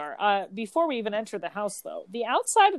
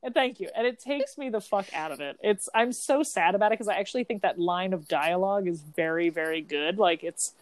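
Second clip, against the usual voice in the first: ordinary delivery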